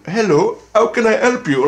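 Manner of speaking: high, loud mock Pakistani accent. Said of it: "with that accent".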